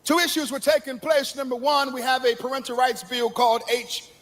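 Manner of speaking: high, ringing sermon delivery; full-force preaching. Acted. Preacher warning the congregation of dangerous times, ringing. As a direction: preach the last days with fire.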